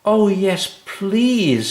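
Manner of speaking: put-on high, eager little girl; eager yes. A narrator's reading. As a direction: jump at the offer. Anna eagerly accepts.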